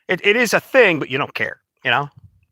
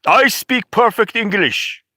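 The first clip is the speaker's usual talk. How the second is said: higher, slow mock Indian accent; 7 semitones higher, slower than usual. Someone in India boasting of fluency, mock Indian accent. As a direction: put on an exaggerated, measured Indian accent. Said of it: "like someone in, in India".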